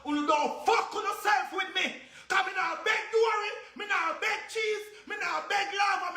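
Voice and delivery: real furious yelling; defiant shouting. Real, not acted. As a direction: hammer each line out in fury. Defiant shouted list in rant.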